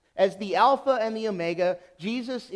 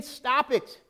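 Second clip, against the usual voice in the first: ordinary talk, nothing marked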